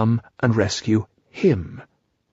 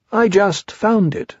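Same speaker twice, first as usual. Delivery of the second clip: higher, slow, simple bear voice; Pooh Bear; 8 semitones higher, slower than usual. A narrator's reading. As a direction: say it slowly and innocently. Pooh's voice in story.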